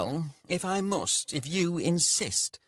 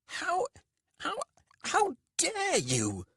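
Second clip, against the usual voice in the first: very high, slow, spluttering outrage; insulted merchant spluttering at his servant; fuming stammer; slower than usual. A narrator's reading. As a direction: choke on the fury. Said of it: "Rika was fuming".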